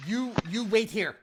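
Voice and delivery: high, insistent flustered order; a panicking mayor insisting they stay put; flustered command. Acted. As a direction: order them to stay, nervously.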